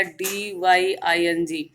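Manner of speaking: slow spelling of डीवाईआईएनजी letter by letter; spelled out. Shown as a demonstration. Teacher reveals the new spelling to students, slowly. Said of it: "क्या बनेगा".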